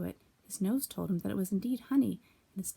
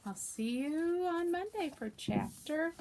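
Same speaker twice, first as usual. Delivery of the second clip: gentle sign-off; 7 semitones higher, 6 dB quieter, much slower than usual. Real, not acted. Signing off to class.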